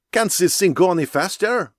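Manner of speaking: put-on high, loud French-accented complaint; thick French accent. Scientist calling from the cabin in a thick French accent. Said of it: "in a thick French accent".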